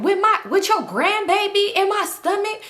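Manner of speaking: high-pitched, mock-indignant imitation; indignant retort. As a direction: throw it back high, as an outraged question. Acting out an indignant quarrel.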